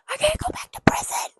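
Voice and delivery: hammed-up high, weepy whisper; fake-cry it over the top; whimpering melodramatic plea; mock sobbing whisper. Mock-crying retake of character line.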